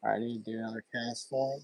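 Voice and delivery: hushed, soft stalking talk; fishing whisper. Real, not acted. Quiet stalking near a fish.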